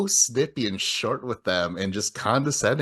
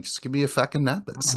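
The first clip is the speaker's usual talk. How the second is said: higher-pitched put-on Boston accent; 5 semitones higher, 3 dB quieter than usual. Cable agent swearing at a customer, mimicked Boston accent. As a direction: swear at the caller in mock Boston. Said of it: "started to do their accent back".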